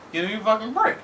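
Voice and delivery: high-pitched, exasperated scoff; exasperated disbelief. Real, not acted. Reviewer balks at a plot turn, exasperated.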